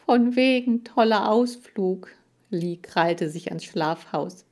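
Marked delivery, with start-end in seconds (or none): 0.1-2.1 high, indignant grumble; scornful dread. A narrator's reading. Hamster Lee dreads the outing, scornful protest. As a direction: echo the words in protest.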